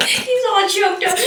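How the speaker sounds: high, loud laughter through the words; laughing. Real, not acted. Host teasing the emotional guest, laughing loudly. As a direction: laugh it out affectionately.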